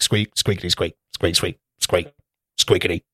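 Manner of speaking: soft little squirrel squeaking; squirrel squeaks. Retold or imitated. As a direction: squeak like a tiny squirrel, low and quiet. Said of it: "the squirrel, like, squeak".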